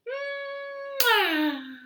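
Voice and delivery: high smacking blown kiss; kiss sound. Acted. Blowing a kiss in rhyme.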